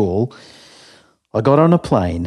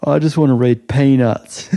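fed up; 5 semitones higher, 4 dB louder than usual. Acted. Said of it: "fed up with the responsibility".

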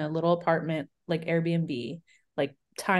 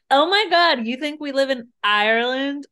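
thrilled; 7 semitones higher, 6 dB louder than usual. Retold or imitated. Retold delighted reaction.

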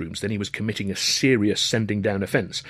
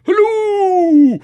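high, loud hunting halloo; shouting; 11 dB louder than usual. A narrator's reading. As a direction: call it out like a huntsman across a lawn.

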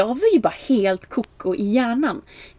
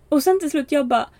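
usual speaking voice

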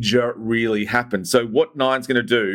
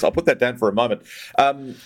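usual speaking voice

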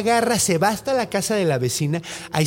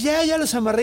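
fake-innocent high voice; 10 semitones higher than usual. Hunter tells cat dogs tied.

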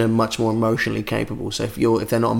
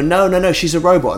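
mock protest; 6 semitones higher than usual. Caught cheater protesting to girlfriend.

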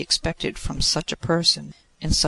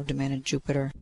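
ordinary delivery, nothing marked

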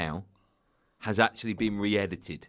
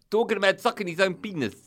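higher, louder South African agent; South African accent; 8 semitones higher, 5 dB louder than usual. Acted. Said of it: "I'll do the accent".

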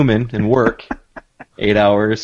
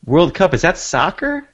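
higher, fake-clueless questions; mock oblivious; 8 semitones higher than usual. Friend mocking the French, mock oblivious. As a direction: play dumb about the World Cup. Playing oblivious American fan.

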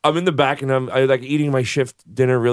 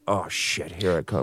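muttered dread; 9 semitones lower, 8 dB quieter than usual. Retold or imitated. Dreading the fight coming.

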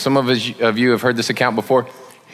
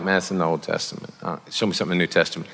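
mock skeptic; 9 semitones lower, 9 dB quieter, much faster than usual. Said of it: "there's some of y'all like".

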